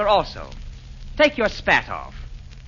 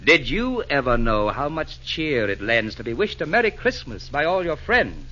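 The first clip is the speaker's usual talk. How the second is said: ordinary talk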